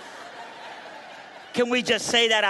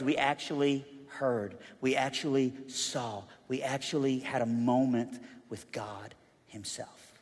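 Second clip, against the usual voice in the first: hushed awe; 7 semitones lower, 8 dB quieter than usual. Acted. Hushed climax of sermon.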